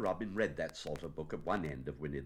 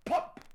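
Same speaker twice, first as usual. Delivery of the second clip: loud, abrupt "pop"; cork pop; 6 dB louder than usual. Acted. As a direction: burst the word like a cork. Imitates cork leaving a bottle.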